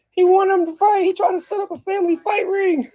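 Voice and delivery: squeaky put-on tattletale voice; mocking commenters. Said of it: "be like".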